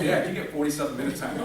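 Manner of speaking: wife scolding. Retold or imitated. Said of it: "It's like".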